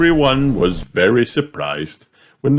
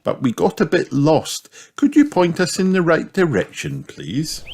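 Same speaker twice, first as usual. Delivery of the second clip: higher, lilting mother pig voice; polite Mummy Pig; 9 semitones higher than usual. Acted. Mummy Pig asks the Bears for directions, polite request. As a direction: ask politely in a lifted storybook-mum voice.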